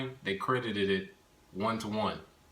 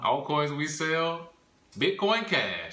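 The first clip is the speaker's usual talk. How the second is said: announcer voice; 6 semitones higher, 4 dB louder, slower than usual. Acted. Dramatic show announcement.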